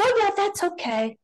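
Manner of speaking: high-pitched mock indifference; dismissive mimicry. Mimics people unmoved by the singer.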